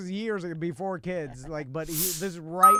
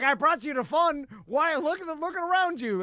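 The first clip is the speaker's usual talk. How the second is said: higher, mock-excited pleading; exasperated urging; 7 semitones higher than usual. Imitating friend urging him to have fun, mock exasperation. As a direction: talk up the party in disbelief.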